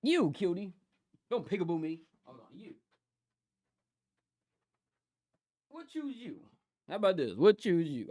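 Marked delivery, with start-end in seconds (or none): 0.0-6.4 soft, high cooing to a pet; pet talk. Real, not acted. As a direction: coo at the little one.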